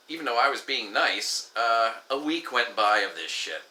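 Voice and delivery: usual speaking voice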